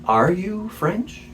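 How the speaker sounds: slow, high, rising question; drawn-out wondering query; retold incredulous question. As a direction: imitate stunned curiosity, stretching every word upward. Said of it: "leans forward and goes".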